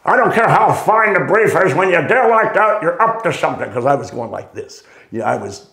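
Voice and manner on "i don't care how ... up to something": put-on higher, louder stern old master scolding; imitating Vernon's sharp rebuke. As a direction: scold the kid like a gruff veteran. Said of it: "finally Vernon goes".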